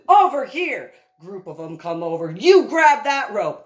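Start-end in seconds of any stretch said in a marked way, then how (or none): 0.1-0.9 loud, high command; shouted call. Retold or imitated. Directing another group of soldiers, shouting. 2.3-3.6 loud, high order; shouted order. Retold or imitated. Ordering a soldier onto the rope, shouting.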